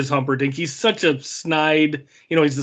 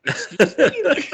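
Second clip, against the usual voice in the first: high, drawn-out incredulous question with laughter; mock disbelief; 10 semitones higher, slower than usual. Viewer reacting to the clergyman, high laughing disbelief. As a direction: squeak it slowly, half laughing. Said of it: "And you're like".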